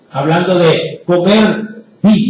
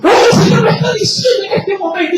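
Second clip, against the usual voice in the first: high, fast, gushing mock revelation; hammed-up breathless announcement; mock excited prophet; 12 semitones higher, faster than usual. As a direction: imitate an eager itinerant prophet. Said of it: "y dicen".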